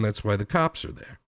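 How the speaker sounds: ordinary talk, nothing marked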